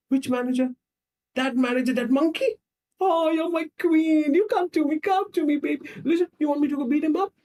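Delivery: high, loud, gushing outrage; over-devoted defender. Acted. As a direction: leap theatrically to your sweetheart's defence. Acted Stephen-type man defending his woman.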